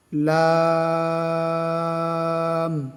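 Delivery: লাম held very long; long chanted লাম; drawn-out lam. Shown as a demonstration. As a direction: stretch লাম four alif lengths. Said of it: "চার আলিফ টান হবে".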